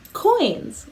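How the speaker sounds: put-on coins said high and clear for kids to copy; high falling coins, teacher model; clear model word. Shown as a demonstration. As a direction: model coins for the class to echo. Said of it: "Can you say coins?".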